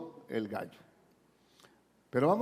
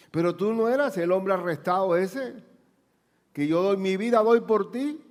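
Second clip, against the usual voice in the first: loud boast; 5 semitones higher, 4 dB louder than usual. Acted. Preacher voices Peter's boast.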